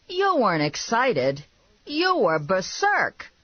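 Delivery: high, emphatic correction; exasperated retort. A narrator's reading. Man correcting her excuse, exasperated. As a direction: draw out the last word, pitch up. Narrator voices Vinny retorting.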